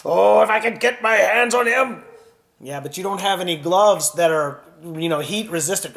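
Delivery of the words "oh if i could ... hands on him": high, loud fuming king; angry king. Acted. King furious at the dragon who burned the castle, loud fuming. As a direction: fume at the dragon.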